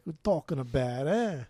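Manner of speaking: hammed-up New York accent; mock New York accent, rising on a punched "eh". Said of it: "More like a New York".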